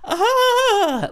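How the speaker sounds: high shrill squeal of a laugh; mocking shriek laugh. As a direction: imitate her shrill laugh. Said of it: "she's like".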